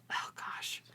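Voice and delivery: breathless whispered oh gosh; whispered dread. Retold or imitated. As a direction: whisper it like bad news is coming. Retelling her reaction to a chapter.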